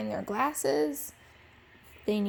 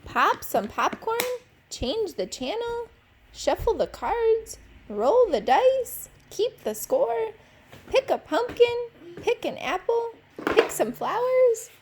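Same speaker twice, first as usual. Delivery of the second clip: higher and louder, piling up requests; lightly voiced aunts pestering higher and higher; aunts' demanding chorus; 9 semitones higher, 5 dB louder than usual. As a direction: build the nagging list of requests. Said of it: "all Max heard was".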